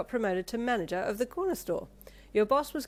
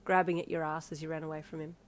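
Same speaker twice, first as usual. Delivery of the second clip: ordinary delivery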